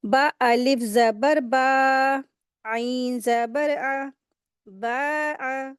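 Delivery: slow drilling of the letters for learners; slow recitation drill. Shown as a demonstration. Teacher to class, spelling out the word: slow drilled syllables. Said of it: "हलक से निकालेंगे आइन को".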